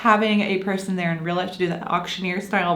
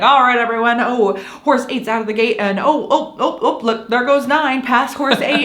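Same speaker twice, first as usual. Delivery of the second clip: animated mock race commentary, raised pitch; race announcer; 4 semitones higher, 4 dB louder than usual. Imitating the app's pre-recorded announcer, excited race call. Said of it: "like, the announcer".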